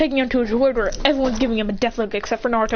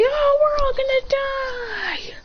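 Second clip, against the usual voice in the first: slow, high-pitched wail; mock panic; much slower than usual. Onlookers seeing the meteor fall, mock wail. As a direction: drag out the last word in play-acted dread. Characters doomed under falling meteor.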